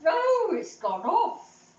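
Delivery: high loud "Oh" then slow; hammed-up dismay, drawn out; mock dramatic "Oh, it's gone off"; exaggerated repeat.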